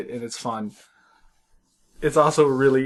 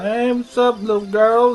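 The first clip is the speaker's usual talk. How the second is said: put-on little boy voice; 7 semitones higher, 4 dB louder than usual. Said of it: "he's just a little boy".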